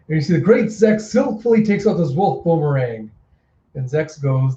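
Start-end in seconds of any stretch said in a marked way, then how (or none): none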